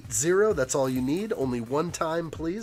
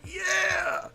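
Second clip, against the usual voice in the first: excited. Real, not acted. Excited about Star Wars ships.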